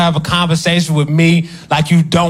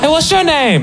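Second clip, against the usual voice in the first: flirty woman; 11 semitones higher, slower than usual. Retold or imitated. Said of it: "sexual encounters".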